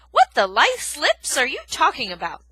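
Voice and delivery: loud, baffled outburst; a porcupine utterly baffled; bewildered. Acted. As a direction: blurt it in total confusion. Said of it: "Aerith asked with genuine bewilderment".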